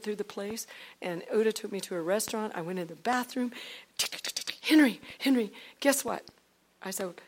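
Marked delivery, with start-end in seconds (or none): 4.6-6.2 louder, higher eager call; excited calling. Retold or imitated. Wife calling her husband with big news, excited. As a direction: burst out with the news.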